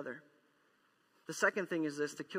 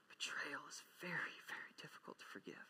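whisper; 14 dB quieter than usual. Real, not acted.

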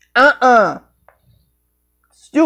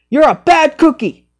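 higher, put-on scolding; scolding character voice; 5 semitones higher than usual. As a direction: scold it in a squeaky voice. Repeated scolding, other voice.

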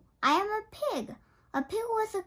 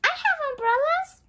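higher and louder, eagerly rising; eager offer; 7 semitones higher, 6 dB louder than usual. Acted. Piggie offering umbrellas to her friend, eager and loud. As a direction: pipe up keen to help.

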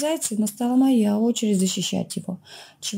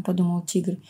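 plain reading voice

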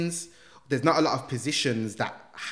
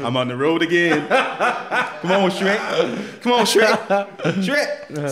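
playful Donkey voice; 6 semitones higher, 9 dB louder, much slower than usual. Pretend. Laughingly playing Donkey from Shrek.

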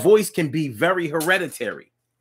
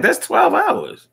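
incredulous; 14 semitones higher, slower than usual. Real, not acted. Stressing shock at hours.

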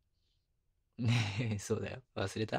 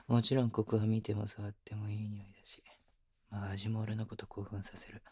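intimate whisper. Acted. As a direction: whisper it close, like only she should hear.

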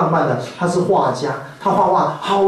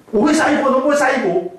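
flustered wife; 8 semitones higher, faster than usual. Retold or imitated. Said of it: "他就骂我说".

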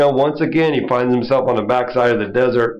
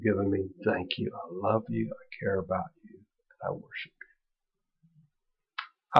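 hushed, softer heartfelt prayer; 2 semitones lower, 4 dB quieter than usual. Acted. The preacher praying his thanks to God, in a hushed prayer. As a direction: pray it softly and sincerely.

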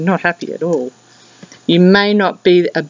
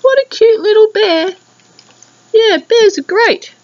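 teasing cute voice; 5 dB louder than usual. Pretend. Mocking the grumpy koala.

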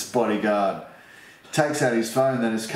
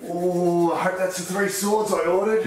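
high-pitched voice for an eager character; excited Uncle Barry; 9 semitones higher than usual. Acted. Uncle Barry hearing his doorbell, high-pitched excitement.

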